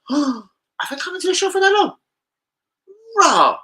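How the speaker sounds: very high, squeaky mock astonishment; shocked exclamation. Regular hearing how long he's been coming, hammed-up shock. Acted astonished reply in a story.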